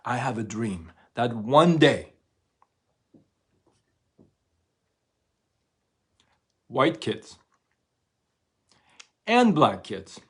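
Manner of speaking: rousing oratory. Retold or imitated. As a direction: rouse the crowd like a preacher. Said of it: "picking up speed".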